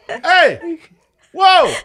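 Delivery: high, loud startled yell; startled shout. Retold or imitated. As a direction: jump at what's on screen and yell. Shocked by the movie scene.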